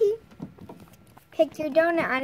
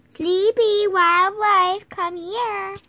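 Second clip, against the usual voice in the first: louder, calling the kittens over; a cat mom calling her kittens to come; 3 semitones higher, 7 dB louder, much slower than usual. Pretend. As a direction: call the names across the room.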